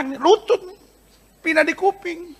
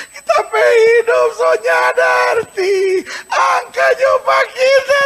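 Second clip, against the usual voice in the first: much higher, loud, tearful shouted plea; crying prayer; 9 semitones higher, 4 dB louder, much slower than usual. Acted. Jabez begging God, crying and shouting. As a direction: cry out to God in desperate prayer.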